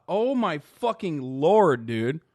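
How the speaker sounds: usual speaking voice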